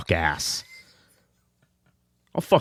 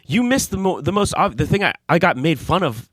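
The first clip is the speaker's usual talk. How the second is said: high, excited protest; indignant outburst; 11 semitones higher, 3 dB louder than usual. Real, not acted. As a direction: jump in, outraged and fast. Indignant protest over his ears.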